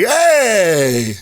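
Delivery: put-on high, drawn-out little-kid greeting; cheered-up toddler. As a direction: mimic a cranky kid perking up. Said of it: "he's mad and then he sees Nate".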